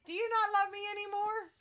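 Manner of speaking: playful high-pitched plea; mock hurt. Real, not acted. Pleading with pet for affection.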